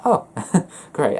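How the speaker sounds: high, amused "oh" breaking into a laugh; playful, chuckling reaction; having a laugh. Acted. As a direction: laugh it off lightly. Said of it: "having a laugh".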